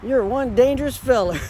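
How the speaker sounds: high and loud, laughing at the dog; laughing pet talk. Real, not acted. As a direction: tease the dog through laughter.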